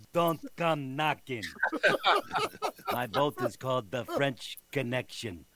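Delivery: Bernie Parent impression. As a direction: play the old goalie, slow and jokey. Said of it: "doing a lot more Bernie Perrant".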